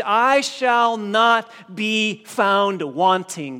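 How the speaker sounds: slow, emphatic pulpit proclamation; preaching at full force. Acted. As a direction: drive the promise home from the pulpit.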